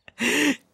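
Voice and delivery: loud high burst of laughter; embarrassed laugh. Acted. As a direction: crack up at yourself. Laughing at own rambling.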